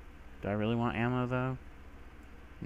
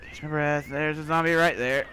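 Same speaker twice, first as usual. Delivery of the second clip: ordinary talk, nothing marked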